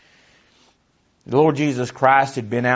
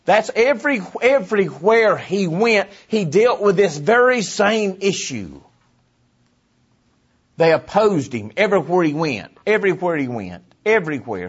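high, loud pulpit cry; forceful preaching; 7 semitones higher, 5 dB louder than usual. Real, not acted. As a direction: drive each phrase home at high pitch.

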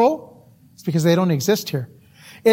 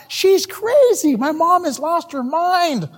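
high, drawn-out mock outrage; incredulous; 9 semitones higher, slower than usual. Teenage son judging his mom for dumping the TV, incredulous. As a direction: stretch it out in appalled disbelief. Re-enacts son's shock at mother.

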